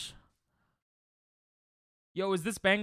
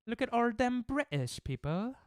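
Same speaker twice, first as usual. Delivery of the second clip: put-on British accent, pitched up; terrible British accent; 7 semitones higher than usual. Streamer mocking British people on screen, terrible British accent. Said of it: "That's a terrible accent".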